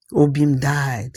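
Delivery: usual speaking voice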